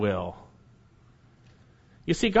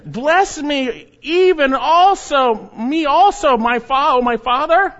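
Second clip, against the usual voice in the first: high, loud, slow desperate plea to his father; bitter pleading cry; 9 semitones higher, 7 dB louder, slower than usual. Acted. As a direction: beg like a son who has lost everything. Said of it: "cried out with an exceedingly great and bitter cry".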